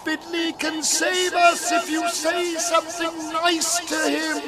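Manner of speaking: high, slow calling voice; urgent shout. A narrator's reading. Algernon pleads with the housekeeper to help Fiddly, shouting. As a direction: call it out, stretching the words. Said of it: "he shouted at the top of his lungs".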